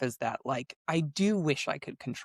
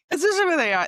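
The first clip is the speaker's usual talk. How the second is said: much higher and louder on especially; excited repeat; 13 semitones higher, 6 dB louder, much faster than usual. Shown as a demonstration. Agreeing with the host's point, repeating it higher and louder. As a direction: echo the phrase higher and louder, hitting especially.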